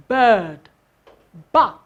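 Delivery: usual speaking voice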